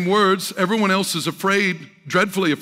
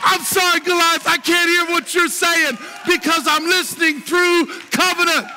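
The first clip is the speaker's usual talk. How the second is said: high, fired-up sermon delivery; full-force preaching; 12 semitones higher, 4 dB louder than usual. Real, not acted. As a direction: preach it boldly at a high pitch to a roused congregation.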